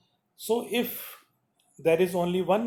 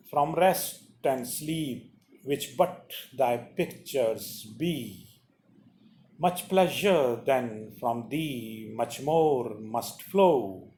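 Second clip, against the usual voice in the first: slow, lower, solemn sonnet reading; poem recitation; 3 semitones lower, slower than usual. Acted. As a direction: read each phrase slowly, giving it weight. Reads the sonnet aloud.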